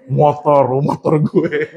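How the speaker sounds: proud boast with a laugh behind it; proud strut. Retold or imitated. Winner strolls into the office, mock-proud low boast. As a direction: lightly crow over your prize, a bit low. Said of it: "jalan bangga banget".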